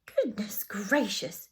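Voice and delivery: slow, drawn-out astonishment; long, airy gasp of surprise; exclamation. A narrator's reading. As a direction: exclaim it with breathy amazement. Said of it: "exclaimed Missus Bird".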